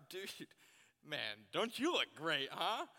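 exaggerated sarcastic tone; sarcastic compliment. Shown as a demonstration. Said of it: "There's a difference in tone".

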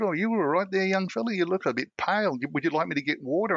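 high, sweet motherly voice; a lightly high nurturing receptionist; kindly lady. Retold or imitated. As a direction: pitch it up like a caring older lady. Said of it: "lady said".

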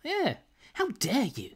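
very high, offended huff; mock affront. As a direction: squeak it out, hammed-up and scandalised. Mocking Zoe's offended reaction.

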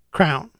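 "Crown" for crayon, one syllable; wife's mispronunciation. Retold or imitated. Husband repeating wife's word for crayon, one-syllable mispronunciation. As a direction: say "Crown" like the king's headwear. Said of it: "says it just like the word".

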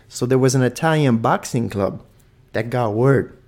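ordinary delivery

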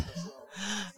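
a short, soft laugh at herself. Real, not acted. Preacher laughing at her own fearless moment, soft laugh. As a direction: laugh lightly at the memory.

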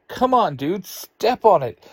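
loud, high, impatient snap at her friends; snapping. A narrator's reading. Late for math, snapping at friends to hurry, loud and high. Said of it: "Ruthie snaps".

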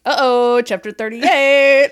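high, loud, drawn-out warning; mock alarm. Imitating friends tracking her live reactions, mock alarm. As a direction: stretch it out as a teasing alert.

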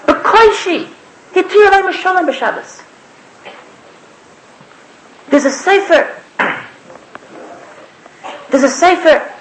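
ordinary talk

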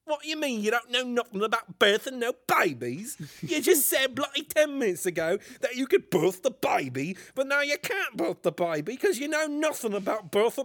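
put-on British accent, movie parody. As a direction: protest in a comic British voice. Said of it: "Can we do my British voice again?".

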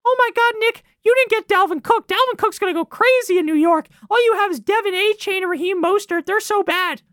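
shrill-free high mock taunt; mocking Jets fans. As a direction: parrot the gloating fans. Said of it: "to all the Jets fans".

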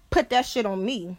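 ordinary delivery

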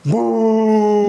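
loud mock boo; crowd booing. Retelling the audience booing Velasco, loud boo. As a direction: boo him like a hostile crowd.

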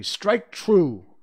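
high, loud resolute command; firm. A narrator's reading. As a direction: urge it firmly, punching both words. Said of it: "he said firmly".

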